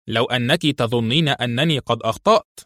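plain reading voice

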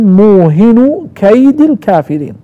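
موهن كيد slowly as muuhinu kaydi; damma, then kasra. Shown as a demonstration. Teacher showing the third reading, kasra on كيد. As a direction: read موهن without nunation and كيد with -i. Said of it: "وكسر الدال".

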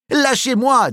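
high strained protest while struggling; struggling against restraints. Acted. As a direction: fight to break free as you say it. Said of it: "dit Jimmy en se débattant".

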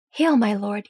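plain reading voice

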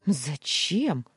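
a dismissive snort of a question. A narrator's reading. Maryam scoffs at loving the child. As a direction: brush the idea off with scorn. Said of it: "фыркнула она".

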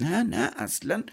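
high-pitched, oily sycophant; servile, sugary high-voiced reassurance; fawning flattery. A narrator's reading. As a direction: fawn over your master in a high voice. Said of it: "شیطان با چاپلوسی گفت".

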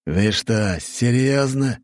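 rising, disbelieving question; can't believe his ears; incredulous king. Acted.

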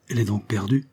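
plain reading voice

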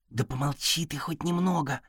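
hissed, soft but irritated; exasperated hush. A narrator's reading. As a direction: shush him, fed up. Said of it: "не выдержал домовой".

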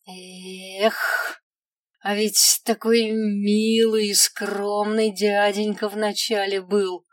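ordinary delivery

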